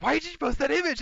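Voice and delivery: high, whiny mock question; a high mock complaint about the posted image; mock annoyed fan. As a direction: squeal it like an offended fan. Some of the people were like.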